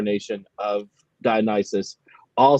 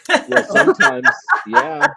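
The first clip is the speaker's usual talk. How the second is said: laughing; 7 dB louder than usual. Real, not acted. Laughing answer to teasing.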